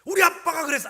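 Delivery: put-on high, loud confident kid's voice; proud child. Son answers the older kid, proud high child voice. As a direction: announce it proudly like a little boy. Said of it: "얘가 이렇게 얘기하는 거예요".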